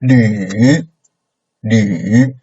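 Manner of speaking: falling-rising LÜ; third tone. Shown as a demonstration. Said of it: "like the third tone".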